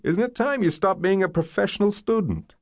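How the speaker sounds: high-pitched, incredulous nagging; exasperated challenge. A narrator's reading. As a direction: needle her about it. Boyfriend challenges her endless studying.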